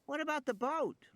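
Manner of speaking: put-on high girl's question; little girl voice. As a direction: ask it like a curious child. Lex asks, high.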